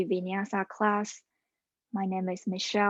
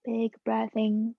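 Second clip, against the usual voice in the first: calm, steady yoga cueing voice; yoga instruction. Acted. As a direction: guide the class through each pose. Leads a yoga class.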